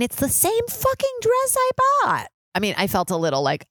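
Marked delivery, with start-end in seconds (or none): 0.0-2.3 very high incredulous squeal; high-pitched, hammed-up outrage; outraged disbelief. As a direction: squeal it in mock horror. Outraged punchline of her dress story.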